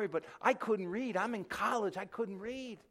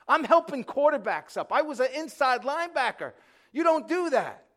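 higher, louder, building excitement; loud, high, energetic testimony; fired-up preaching; 7 semitones higher, 9 dB louder than usual. Real, not acted. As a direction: preach it with rising fire. Preacher's lively climax.

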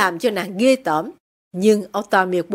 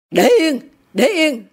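higher, impatient order; irritated father; 6 semitones higher, 4 dB louder than usual. A narrator's reading. Husband cuts off his wife, irritated. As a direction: brush her off irritably.

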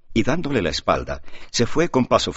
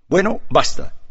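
short, sharp and louder, cutting it off; curt and dry; 11 semitones higher, 6 dB louder than usual. A narrator's reading. Juan cuts his brother off, dry and curt. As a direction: snap it shut, higher and louder. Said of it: "dijo secamente Juan".

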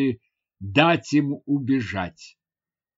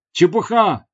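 higher, louder dismissive exclamation; 6 semitones higher, 6 dB louder than usual. A narrator's reading. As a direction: exclaim it to brush off doubt. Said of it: "воскликнул я". (возглас)

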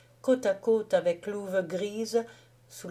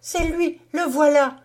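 high, loud exclamation; young woman calling out, loud and high; excited cry; 9 semitones higher, 9 dB louder than usual. A narrator's reading. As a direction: cry out as you spot him. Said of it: "cria la jeune femme".

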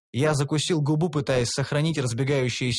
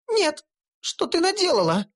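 put-on much higher, dismayed girl's cry; gnome exclaiming. A narrator's reading. Gnome horrified at elf, high cry. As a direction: cry out in horror. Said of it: "воскликнула гномка".

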